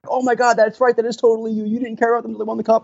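high, quick mock-excited teasing; retold fans teasing. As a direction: rattle it off like a gleeful heckling fan. Fans' mocking reply retold.

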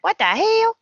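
high, startled what the hell; shocked disbelief. Retold or imitated. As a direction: squeal it in disbelief. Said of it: "Thomas is like".

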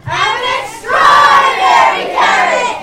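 slow, loud proud shout. Acted. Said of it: "Get your fingers ready".

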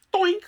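high, loud cartoon "Doink!"; playful doink. Therapist bonking client with an imaginary jelly bean, playful doink. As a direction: bonk him with a silly sound. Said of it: "and I'd just go".

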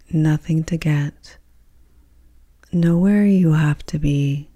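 guided meditation. Acted. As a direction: guide them gently into deep rest, with long pauses. Leads a yoga nidra meditation.

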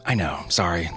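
normal talking voice

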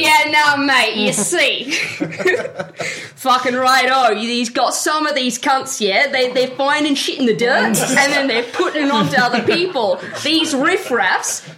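put-on [name] hamming up a tipsy Australian accent. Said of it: "doing an Australian accent".

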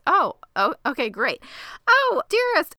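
high, loud sudden gushing; mock flustered cover. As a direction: cover the panic with bright fake charm. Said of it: "And Henry says".

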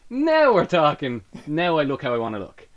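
delighted. Retold or imitated. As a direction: relish the moment of finally seeing yourself. Delighted at shaved head.

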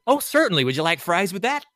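eager order-taker voice; obliging server. Retold or imitated. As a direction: chirp it back as the suddenly helpful counter worker. Acted clerk suddenly obliging.

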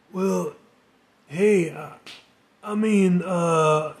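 halting, fumbling start; struggling for words. Acted. Stricken friend fumbles for a reply, halting. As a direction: search for words that won't come. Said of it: "he struggled for words".

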